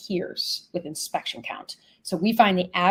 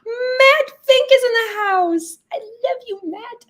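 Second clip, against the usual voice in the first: high, drawn-out squeal of greeting; excited fangirling; 6 dB louder, slower than usual. Real, not acted. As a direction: gush over a favourite guest, pitched way up. Hailing a favourite participant.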